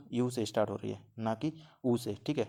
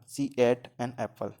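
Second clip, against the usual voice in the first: slow example reading; much slower than usual. Shown as a demonstration. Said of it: "पहला example क्या है हमारा?".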